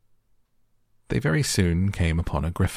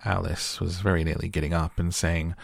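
usual speaking voice

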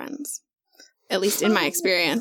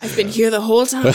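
mock villain reveal; 7 dB louder than usual. Acting out creepy reveal.

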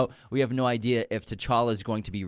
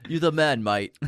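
frat bro; 4 semitones higher, 4 dB louder than usual. Retold or imitated. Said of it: "I can only imagine he was like".

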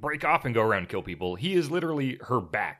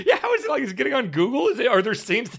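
laughing; 6 semitones higher, 3 dB louder than usual. Real, not acted. Joking about the plot while laughing.

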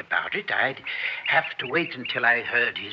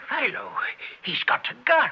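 alarmed; 10 semitones higher than usual. Acted. Max alarmed at the gun.

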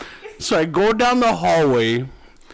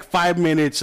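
normal talking voice

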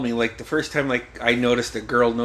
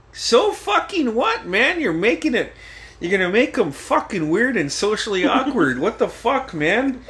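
exasperated protest; 8 semitones higher than usual. Retold or imitated. Brother standing up for him against mom, exasperated protest. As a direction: imitate a brother arguing back, pitched up.

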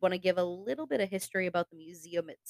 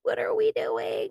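high squeaky inner voice; body's inner voice. Retold or imitated. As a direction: voice your tired body, way up high. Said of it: "my body is starting to be like".

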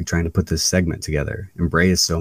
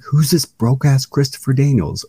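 higher, mock-incredulous question; incredulous commenter; 8 semitones higher, 3 dB louder than usual. Wrestler quoting YouTube commenters, mock incredulous. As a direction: imitate a baffled online commenter. Quotes mocking online commenters.

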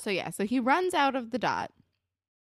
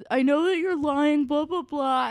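loud, higher imitated accusation; mock accusing; 7 semitones higher, 8 dB louder than usual. Retelling Spinner accusing Marco of lying, mock heated.

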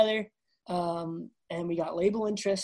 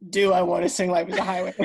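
laughing disbelief; 5 dB louder than usual. Retold or imitated.

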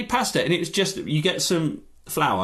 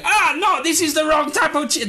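much higher and louder mock Italian boyfriend; bad Italian accent; 13 semitones higher, 5 dB louder than usual. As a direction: ham up a failed Italian accent in outrage. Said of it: "that's not an Italian accent".

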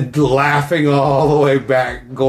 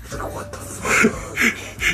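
stunned whisper; 7 dB quieter, much slower than usual. Retold or imitated. Stunned by kid's joke.

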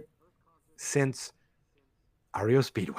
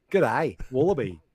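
hammed-up Aussie; broad mock Australian; demonstrated Australian accent. Shown as a demonstration. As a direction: show off a put-on Australian accent. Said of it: "Here's my real accent".

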